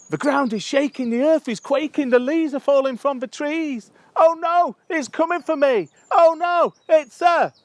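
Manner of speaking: high, loud panicked cry; hammed-up terror. Someone scared of a bumblebee, hammed-up terror. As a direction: wail the poem in mock panic. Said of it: "someone who's scared of one".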